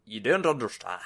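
hammed-up Scottish accent, playing the husband; mock Scottish accent. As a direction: put on a comic Scots voice. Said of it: "I can do a Scottish accent".

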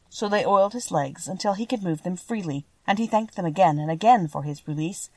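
ordinary delivery

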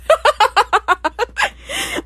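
high cackling laugh; laughing fit. Real, not acted. Host cracking up at beetroot lyric, laughing. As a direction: crack up hard.

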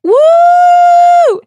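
a big, high, loud cheering whoop; celebratory shout; excited whoop. Real, not acted. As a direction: whoop with delight at the idea. Cheering the fanfic idea.

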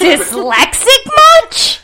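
slow, high, loud mocking jab; teasing. Real, not acted. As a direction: drag it out to tease. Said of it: "It's not easy".

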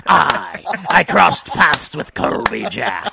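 slow, storytelling pirate drawl. Acted. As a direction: spin a sea tale like an old pirate. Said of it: "like you're saying the name of another pirate".

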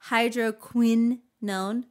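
hydroquinone broken as hydro-KWINN... own; overemphasized qui. Real, not acted. Reader defending herself to co-host, overstressed QUIN in hydroquinone. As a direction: hit and hold QUIN in hydroquinone. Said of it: "why you wanna emphasize qui so bad".

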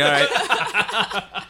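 mock-unimpressed okay through a laugh; amused, unconvinced shrug of a reply; laughing all right. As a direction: humour them while laughing. Reacting to odd decor.

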